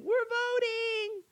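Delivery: very high, slow squeal from far off; distant excited reply. Retold or imitated. Woman answers in the background, high mock squeal. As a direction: call it back from behind the camera. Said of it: "in the background".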